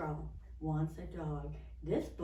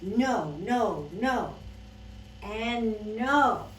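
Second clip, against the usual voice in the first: loud, separate, stretched noes; lightly acted fed-up child; emphatic refusal; 4 semitones higher, 8 dB louder, much slower than usual. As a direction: refuse each one firmly. Girl refuses all the animals.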